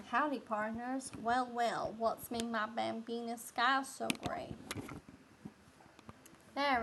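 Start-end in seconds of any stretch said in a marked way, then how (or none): 0.1-4.5 put-on country accent. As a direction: greet them like a country-accented saloon cowboy. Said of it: "I'm about to do a country accent".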